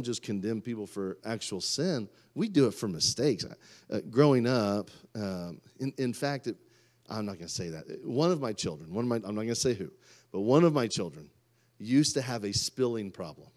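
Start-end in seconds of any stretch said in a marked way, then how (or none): none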